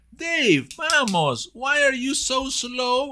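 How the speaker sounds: loud, high, drawn-out chiding of a slowpoke; acted impatient urging. Teacher acting out hurrying slow Dave, impatient and loud. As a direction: call out as if hurrying someone along, slowly stressed.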